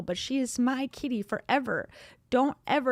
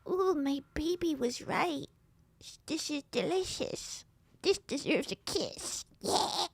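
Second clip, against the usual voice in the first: imitating husband; 4 semitones higher, 5 dB quieter than usual. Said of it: "And then I say".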